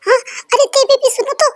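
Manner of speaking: high, laughing call after her. Acted. Calling after the friend who walked away, laughing.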